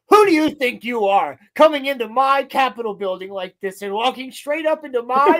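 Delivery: high, loud mock-indignant rant; pompous dressing-down, acted out loudly; imitated scolding. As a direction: tell off an intruder, hamming up the outrage. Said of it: "he's scolding Grievous in his face".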